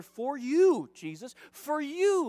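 YOU pitched high and drawn out; insistent stress on YOU. Acted. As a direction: lean hard on each YOU, acted pleading. Acting out Martha's insistent plea.

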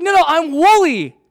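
high, loud child imitation; insistent little boy. Five-year-old son correcting his dad, insistent.

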